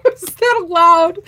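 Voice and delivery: high, loud giggly ask; teasing giggle; giggling question. Real, not acted. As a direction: laugh it out, half-joking. Giggling after stab joke.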